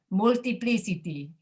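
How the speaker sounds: "multiplicity" Spanish-style, every I a full "ee"; Spanish pronunciation. Shown as a demonstration. Teacher imitating Spanish speakers for the class, Spanish-accented "multiplicity". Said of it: "If you speak Spanish, you're likely to pronounce it".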